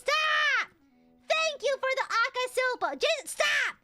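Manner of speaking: high, loud yelled protest and thanks; frantic, overwhelmed yelling; exasperated shouting. Real, not acted. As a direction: shout your thanks as if begging for mercy. Shouted thanks while begging donors stop.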